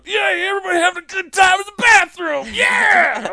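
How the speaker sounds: high, hollered crowd greeting; rowdy drunken cheer, imitated; loud whooping. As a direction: shout it like hyping up a crowd. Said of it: "I'm loud coming out".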